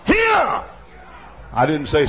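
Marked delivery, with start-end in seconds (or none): 0.0-0.6 high, loud sharp bark; sharp shout. Shown as a demonstration. Preacher shouting at an unruly toddler, sharp bark. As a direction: snap the word out to freeze the child. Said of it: "Say it".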